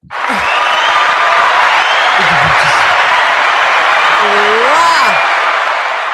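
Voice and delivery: a loud, roaring burst of laughter. Real, not acted. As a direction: let out a huge unvoiced laugh. Big laugh at the joke.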